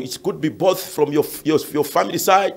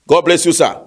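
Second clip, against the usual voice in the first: usual speaking voice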